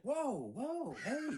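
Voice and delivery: high, laughing mock whoa; startled whoa. Wife startled by the dad's sudden stern voice. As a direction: act caught off guard, half laughing. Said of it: "And she's like".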